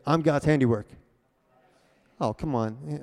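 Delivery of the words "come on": ordinary talk, nothing marked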